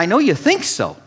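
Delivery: ordinary delivery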